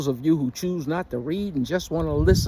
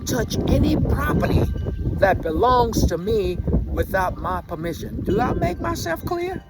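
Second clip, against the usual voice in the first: stern warning building; 7 semitones higher than usual. Retold or imitated. Grandfather warning his grandson, rising to a stern near-shout. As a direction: build the warning up, higher with each phrase.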